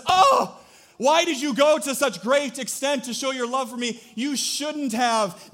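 hammed-up swooning wife; mock gushing wife. Wife gushes over husband's anniversary roses, high falsetto. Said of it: "And she says".

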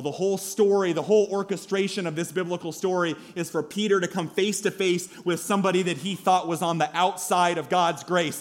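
loud, driving sermon delivery; forceful preaching. Real, not acted. Preacher urging never to write anyone off, forceful preaching. Preacher drives home the point.

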